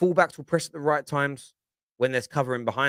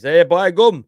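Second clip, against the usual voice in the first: loud, higher-pitched mock Yorkshire accent; 5 semitones higher, 9 dB louder than usual. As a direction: put on a big, loud Yorkshire voice. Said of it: "He talks like that, you see".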